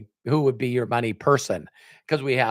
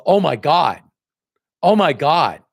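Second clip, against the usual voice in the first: louder, amazed oh my god twice; amazed exclamation; 3 semitones higher, 6 dB louder than usual. Retold or imitated. Mentor hearing the top sponsor's name, amazed and louder. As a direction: exclaim it like great news.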